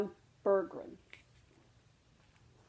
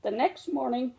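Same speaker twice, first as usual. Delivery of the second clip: usual speaking voice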